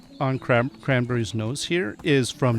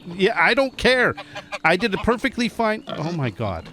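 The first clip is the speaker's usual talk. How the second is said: higher, huffy I did a perfectly fine job; mock defensive; 6 semitones higher than usual. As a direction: act wounded pride, playfully. Defends her shearing to sheep.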